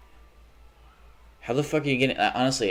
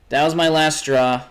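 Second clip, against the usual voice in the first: higher and louder, fed up; loud exasperation; 6 semitones higher, 6 dB louder than usual. Real, not acted. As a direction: shout the last straw. Repeats line, raised and loud.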